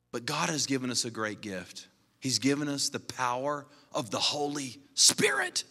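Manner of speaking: ordinary talk, nothing marked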